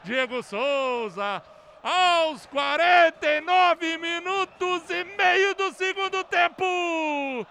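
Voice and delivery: climbing, slow, shouted recap; explosive goal commentary. Real, not acted. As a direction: build each word to a roar.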